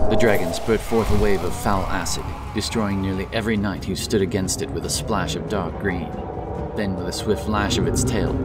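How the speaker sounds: ordinary delivery, nothing marked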